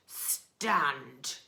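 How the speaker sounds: loud, booming highway rat; booming bandit rat; thundering rat. Acted. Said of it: "who thundered".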